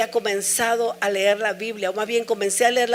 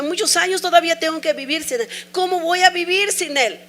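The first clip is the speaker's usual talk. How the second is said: higher, louder despairing questions; retold grief, pitched up and pressing; anguished questioning; 7 semitones higher, 4 dB louder than usual. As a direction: ask how to go on, near breaking. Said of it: "y decía".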